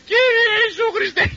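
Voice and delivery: very high, drawn-out parrot mimicry; parrot's wake-up prayer. Elder mimicking the parrot that wakes him, high stretched squawk. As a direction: squawk it like a parrot waking someone. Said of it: "αυτός με ξυπνάει και λέει".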